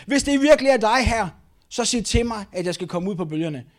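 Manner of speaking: loud, high call across water; acted shout, easing at the end; shouting back. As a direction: holler it out to someone far off. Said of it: "Straks råbte Peter tilbage".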